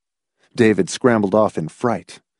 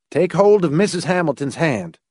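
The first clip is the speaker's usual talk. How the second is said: higher-pitched character giving an order; instructing a boy; 6 semitones higher than usual. A narrator's reading. Laws directing the boy on the stairs, instructing. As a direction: give the instruction in a raised pitch. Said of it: "he instructed the boy".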